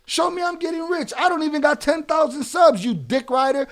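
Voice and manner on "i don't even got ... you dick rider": normal talking voice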